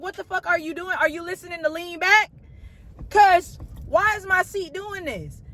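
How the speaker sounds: high, baffled demand; retold annoyance, high and slowing at the end; incredulous complaint. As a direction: question the passenger in disbelief. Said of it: "I'm like".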